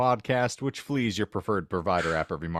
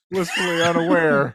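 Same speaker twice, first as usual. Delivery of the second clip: loud mock call; 6 semitones higher, 6 dB louder than usual. Host calls out over co-host.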